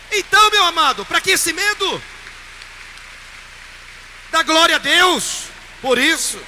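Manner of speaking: high, loud fervent sermon voice; full-force preaching. Acted. Priest rouses his congregation against fear, preaching loudly. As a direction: preach it out to the whole church.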